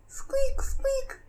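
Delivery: tiny high squeaky mouse; mouse squeak. Acted. Animals answer in the fog.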